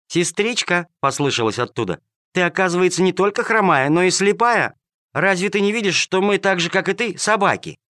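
high, playful kitten voice teasing its sister; a giggling little kitten mocking the lame cat.

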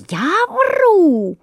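high, loud outraged cry; indignant yell. A narrator's reading. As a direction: bellow it back in disbelief. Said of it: "орал Воробей Воробеич".

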